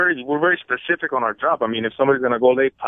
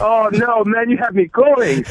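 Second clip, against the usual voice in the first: laughing; 9 semitones higher, slower than usual. Real, not acted. Plumber learns it's a prank.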